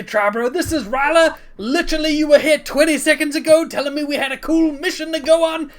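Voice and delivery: high, loud, rambling enthusiasm; boisterous, friendly Rock figure. Acted. Rock presenting his good friends to the crowd, boisterous holler. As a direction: introduce everyone at full, cheerful volume. Game master plays Rock.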